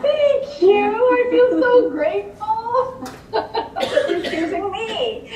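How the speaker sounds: high, laughing, slow gush of thanks; mock gushing gratitude. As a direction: play the naive new hire overflowing with thanks. Naive grateful new hire.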